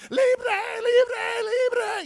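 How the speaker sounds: very high, loud joyous cry; ecstatic shouting. Retold or imitated. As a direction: scream freedom at the top of the voice. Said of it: "he's going".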